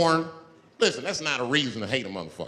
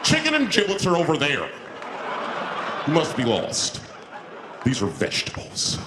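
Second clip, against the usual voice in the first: a lightly voiced snooty shopper; condescending shopper. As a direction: talk down to him.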